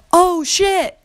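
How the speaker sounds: high-pitched mock yell; retold yell, high and sharp; yelled curse. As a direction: shriek it like a startled curse. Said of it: "at the top of my lungs".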